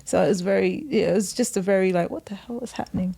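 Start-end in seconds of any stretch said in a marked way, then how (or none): 2.1-3.1 quick unvoiced whisper; whispering what the hell is happening. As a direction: hiss the question in disbelief. Retold confusion.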